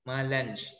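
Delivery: "Malanj" with a hard j at the end; beginner's literal misreading. Shown as a demonstration. Teacher repeats beginners' mistake, soft "Malanj" ending in j. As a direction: softly say "Malanj", closing on j. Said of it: "Bacanya gini, nak".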